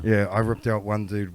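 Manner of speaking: normal talking voice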